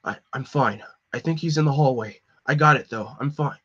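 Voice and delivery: normal talking voice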